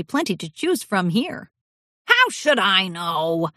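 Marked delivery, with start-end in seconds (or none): 2.1-3.5 put-on slow, gruff old pony grunt; grunting old stallion. A narrator's reading. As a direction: grunt it like a cranky old man. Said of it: "Alabaster grunted".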